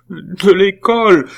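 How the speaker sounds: high, loud, drawn-out wail; redoubled sobs. A narrator's reading. Nephew confessing expulsion to his aunt, sobbing. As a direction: wail it like a blubbering boy. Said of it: "les sanglots de Pitou redoublèrent".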